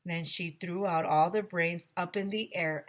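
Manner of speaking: ordinary delivery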